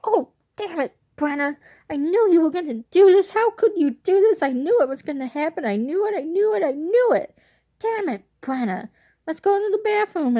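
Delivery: high, loud imitation of a scolding grandma; exasperated grandma. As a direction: play the fed-up grandmother, rising. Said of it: "her reaction was hilarious".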